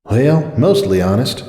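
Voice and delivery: slow, wry aside. A narrator's reading. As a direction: say it with a wry smile. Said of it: "she thought with a wry smile".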